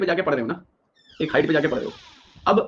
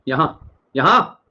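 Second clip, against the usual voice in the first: drawn-out pointing; 4 dB louder, much slower than usual. Real, not acted. Teacher pointing, drawing attention.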